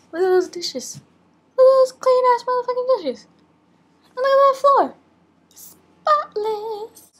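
high, loud squealing showoff; excited squeal. Real, not acted. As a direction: squeal with pride at the clean room. Excited kitchen tour.

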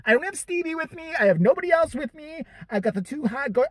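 high-pitched, worked-up complaint; exasperated protest. Acted. Man protests his missed chance to God, exasperated. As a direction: plead your case in a high, incredulous voice.

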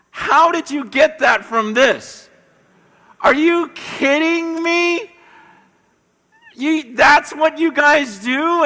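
mock astonished hosts; incredulous. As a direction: ask as if it cannot be true. Hosts laughing in disbelief.

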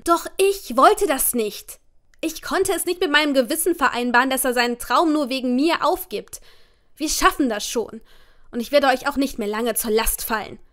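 loud, high, tearful outburst; shouting at family. A narrator's reading. Upset daughter yells at her parents to leave her alone, loud. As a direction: let it all burst out at them.

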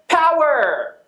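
high, punchy catchphrase call; pumped-up signature shout. Acted. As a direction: punch it out like a show slogan.

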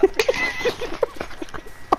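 breathy laugh. Real, not acted. Laughing at the monster.

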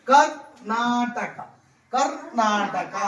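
very slow Karnataka, each syllable stretched; teacher modelling the word slowly; slow demonstration. Shown as a demonstration. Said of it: "ఇప్పుడు చూడు".

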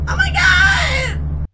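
very high, slow, drawn-out shout; long high scream of oh my God; alarmed shout. Real, not acted. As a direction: wail it out in alarm.

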